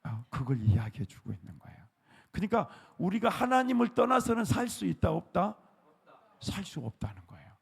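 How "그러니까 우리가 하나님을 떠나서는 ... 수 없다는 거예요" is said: loud, high, urgent sermon delivery; preaching at full force. Acted. Pastor exhorting the congregation, loud high preaching. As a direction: drive the point home to the congregation.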